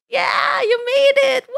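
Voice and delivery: high, teary cry of joy; squealed welcome. Acted. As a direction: cheer that they came back.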